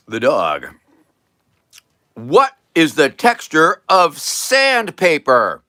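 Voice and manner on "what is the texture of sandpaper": loud, high, slow question; showman quizzing. Acted. Man says to the dog, showman quizzing. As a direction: put the question grandly to the dog.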